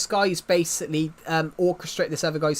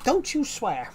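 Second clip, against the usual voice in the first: mock scolding; 8 semitones higher, slower than usual. Mock scolding over swearing.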